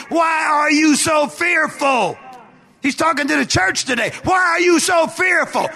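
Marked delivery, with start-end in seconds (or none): 0.1-2.2 slow, raised, shouted question; high, drawn-out challenge; forceful rebuke. Acted. Same question preached at the church. 4.2-5.7 high, driving shouted list; raised, relentless preacher cadence; full-force preaching. Real, not acted.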